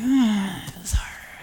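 deflate like someone tired of waiting; weary whine. Retold or imitated. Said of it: "we're like".

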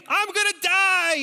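high, loud panicked scream; screaming kid. Retold or imitated. Boy in the dark cave screaming to the leaders, high and loud. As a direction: shriek it like a terrified child. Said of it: "And so I scream".

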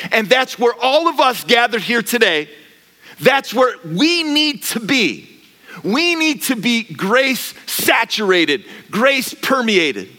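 slow it down and let each word land high; slow emphatic preaching. Acted. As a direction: pastor calling the gathered church to grace, slow and high. Preaching climax.

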